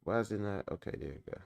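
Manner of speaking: ordinary talk, nothing marked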